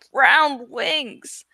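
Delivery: usual speaking voice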